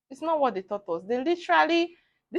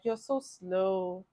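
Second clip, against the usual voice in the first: slow, low, quiet put-down; disdainful lecturer; 4 semitones lower, 7 dB quieter, much slower than usual. Retold or imitated. Lecturer belittling a student at the hospital, slow and disdainful.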